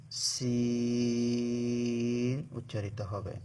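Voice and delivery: সিন held long with a steady hiss; drawn-out sin. Shown as a demonstration. Teacher showing students the letter সিন, drawn out. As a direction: stretch the সিন out slowly. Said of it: "তখন এখান থেকে".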